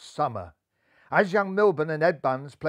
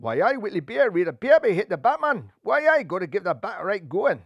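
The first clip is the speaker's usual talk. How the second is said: broad Geordie, louder and higher; Geordie accent; 5 semitones higher, 5 dB louder than usual. Acted. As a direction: boast it in Geordie. Geordie player introduction.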